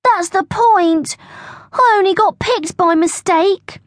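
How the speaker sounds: plaintive schoolboy protest; groaning. A narrator's reading. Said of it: "groaned Bertie".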